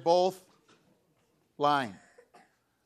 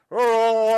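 long loud high "Oh" yell; drawn-out shout; 7 semitones higher, 16 dB louder than usual. Retold or imitated. Blind man reacting to a passing crowd, drawn-out shout.

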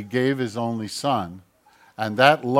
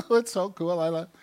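high, gushing mock-giddy squeal; mock giddy delight, pitched way up; giddy excited; 8 semitones higher than usual. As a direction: play a gushing, giddy believer. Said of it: "happy and giddy and excited".

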